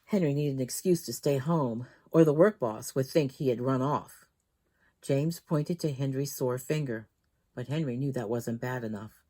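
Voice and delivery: ordinary delivery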